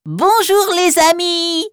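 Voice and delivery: high, slow squeaky mouse greeting; little mouse voice. Acted. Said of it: "avec une petite voix de souris".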